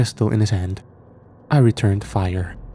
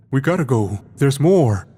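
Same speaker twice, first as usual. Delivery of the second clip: raised, urgent call to move out; urgent shout; 4 semitones higher than usual. A narrator's reading. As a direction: warn your friend there are more coming. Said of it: "he shouted".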